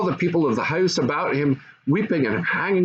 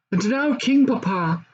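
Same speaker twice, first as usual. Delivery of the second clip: put-on lightly high child's voice; little princess; 6 semitones higher than usual. A narrator's reading. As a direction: coax her father.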